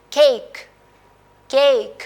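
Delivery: केक said loud with a long ए; teacher demonstrating the long vowel in केक. Shown as a demonstration. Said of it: "long vowel sound".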